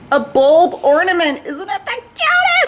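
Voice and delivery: very high delighted squeal; squealed, high-pitched enthusiasm; gushing delight. Real, not acted. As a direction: coo over something adorable. Showing off her bulb ornament.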